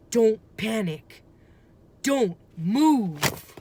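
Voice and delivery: loud firm urgent command; firm urgent order. A narrator's reading. Jack telling Annie not to move near the dinosaur, urgent. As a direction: order her to freeze. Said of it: "said Jack".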